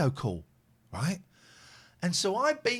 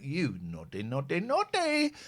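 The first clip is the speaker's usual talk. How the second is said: mock scolding; 3 dB quieter, slower than usual.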